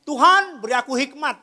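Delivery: loud, high pleading call; mock pleading prayer. Congregant begging God for wisdom, loud mock pleading. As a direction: cry out to God in mock earnest. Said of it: "Kita minta kasih hikmat".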